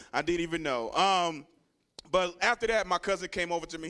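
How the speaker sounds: ordinary delivery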